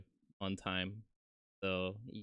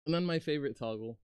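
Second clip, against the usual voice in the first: higher, louder and fast announcement; excited; 6 semitones higher, 5 dB louder, much faster than usual. Real, not acted. Showing viewers the favourite guitar toggle, excited.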